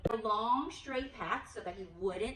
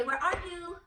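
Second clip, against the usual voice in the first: calling out; 8 semitones higher, 5 dB louder than usual. Shown as a demonstration.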